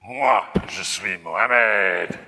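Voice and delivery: put-on loud, drawn-out French accent; outrageous French accent. Cartoon artiste's final frame, loud French accent. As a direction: proclaim it slowly in a hammed-up French accent. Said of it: "He speaks with an outrageous French accent".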